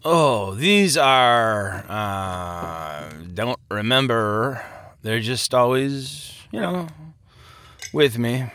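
put-on slow, drawn-out drunken groping for words; slurred drunk. A narrator's reading. Drunk man tries to introduce his companions, slurring slowly. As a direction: trail along woozily, forgetting mid-thought. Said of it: "he slurred".

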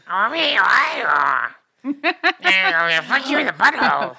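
loud mock creature voice; hormone monster voice. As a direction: ham up a lusty cartoon monster. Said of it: "sex monster of Big Mouth".

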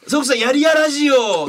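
high forced cheerful greeting. Retold or imitated. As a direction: push the greeting out brightly. Repeats colleague's forced greeting.

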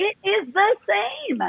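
theatrical insistence. Pretend. Coach insisting coaching equals performing, high drawn-out theatrical delivery. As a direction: stretch each word out high, as if performing. Playfully performing a rebuttal to interviewer.

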